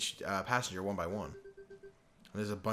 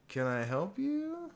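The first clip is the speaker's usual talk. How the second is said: high, slow, drawn-out question; put-on reporter voice; 10 semitones higher, much slower than usual. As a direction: stretch it out and lift it high, hammed-up. Another try at reporter's line.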